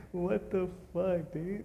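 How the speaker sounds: high, drawn-out disbelief; stunned by own work; amazed. Real, not acted. Amazed at coat.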